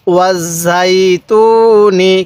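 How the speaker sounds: ওয়াজাইতুনি joined into one word and drawn out very slowly; slow recitation. Shown as a demonstration. Teacher modelling ওয়াজাইতুনি for students, very slow and drawn out. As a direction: stretch every syllable of ওয়াজাইতুনি for the learner. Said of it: "কিভাবে শিখব?".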